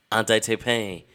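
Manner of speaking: normal talking voice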